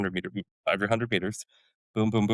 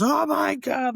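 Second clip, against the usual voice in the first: high excited squeal of joy from the whole unit; a group going wild over party balloons; delighted cry; 3 dB louder, much slower than usual. Retold or imitated. Soldiers see the balloons.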